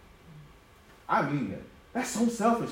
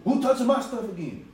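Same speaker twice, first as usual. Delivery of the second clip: mock outrage; 8 semitones higher than usual. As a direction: act out someone getting riled up. Imitates someone enraged.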